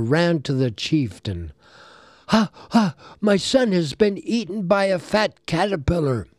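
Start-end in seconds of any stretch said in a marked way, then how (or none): 2.3-6.3 moaning father. Acted. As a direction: wail it like a grieving father. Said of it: "he moaned".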